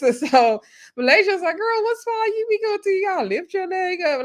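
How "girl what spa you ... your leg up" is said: high-pitched incredulous questioning; playful, high "girl, what?" reaction; mock disbelief. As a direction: imitate a friend's baffled outburst, pitched way up. Acting out Malaysia's disbelieving question.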